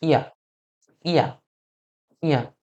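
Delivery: "ইয়া" said short and simply; short, unforced "ইয়া" for learners to copy; simple mouth sound. Shown as a demonstration. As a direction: model "ইয়া" easily from the mouth, pausing after each. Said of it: "simply উচ্চারণ করবেন মুখ দিয়ে".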